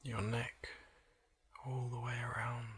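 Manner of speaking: soft, slow relaxation voice with long pauses; hushed, unhurried body-scan meditation; guided meditation. Acted. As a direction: gently guide the listener into calm, leaving space between phrases.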